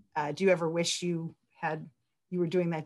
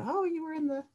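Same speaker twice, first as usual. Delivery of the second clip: much higher, gushing fan who recognises you; hammed-up starstruck acquaintance, higher and louder; excited fan; 9 semitones higher, 6 dB louder than usual. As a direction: squeal it like someone spotting a minor celebrity. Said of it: "came over and said".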